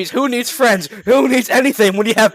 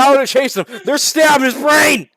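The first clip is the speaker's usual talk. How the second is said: mock shout; 5 semitones higher, 4 dB louder than usual. Shouted slow reading.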